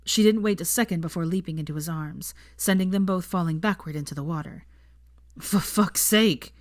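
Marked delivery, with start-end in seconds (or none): 5.4-6.6 gasped curse. Acted. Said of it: "he gasped".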